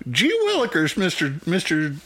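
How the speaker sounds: put-on very high, eager old-timey boy; wholesome 1950s kid. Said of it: "and he's like".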